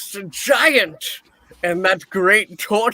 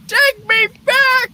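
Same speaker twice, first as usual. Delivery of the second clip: much higher, louder cry of take; anguished cry; 14 semitones higher, 4 dB louder than usual. Acted. Scrooge cries out to the ghost, anguished. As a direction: shriek the plea.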